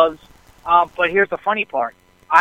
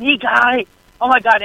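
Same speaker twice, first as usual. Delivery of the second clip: retold excited scream; 5 semitones higher than usual. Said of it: "I'm basically just screaming".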